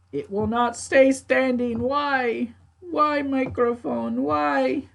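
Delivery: high, slow, pleading whine at the microphone; begging the mic to stay up, half joking; exasperated plea. Real, not acted. Streamer pleading with falling microphone, high exasperated whine.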